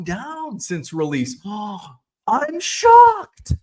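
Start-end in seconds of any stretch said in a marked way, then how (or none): 1.4-1.9 mock gasp. Sarcastic reaction to price drop. 2.3-3.5 high, slow and loud, fake astonishment; hammed-up disbelief, dripping sarcasm; sarcastic mock shock. Sarcastic reaction to price drop.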